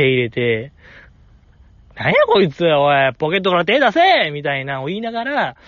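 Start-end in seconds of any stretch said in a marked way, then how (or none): 1.9-4.3 mock rough heckling. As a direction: heckle with pretend outrage. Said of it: "かなり語気荒めに応援上映したい".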